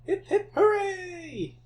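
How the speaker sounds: slow, high, laughing hooray; mock cheer. Mock celebration after a win.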